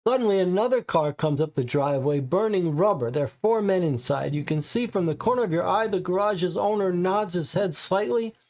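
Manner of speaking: ordinary talk, nothing marked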